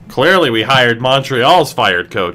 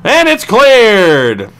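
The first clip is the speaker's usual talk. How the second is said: slow triumphant call; 7 semitones higher, 4 dB louder, much slower than usual. Real, not acted. Penalty kill clear.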